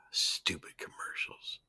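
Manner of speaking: sighing, whispered complaint under the breath; annoyed sigh then a whispered gripe; whispered grumble. Real, not acted. Annoyed at ad, muttering.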